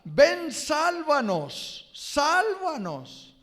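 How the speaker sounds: high, slow, loud plea to be saved; pleading cry. Retold or imitated. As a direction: cry out for rescue, drawn out. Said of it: "que significa".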